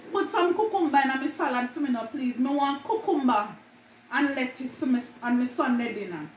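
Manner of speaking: casual Jamaican patois example lines. Shown as a demonstration. Vlogger acting out an everyday Jamaican line, in patois. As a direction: chat it like a Jamaican at home. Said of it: "words that Jamaican mispronounce".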